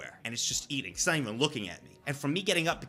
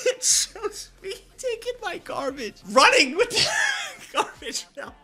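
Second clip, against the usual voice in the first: laughing; 4 dB louder than usual. Real, not acted. Laughing while telling.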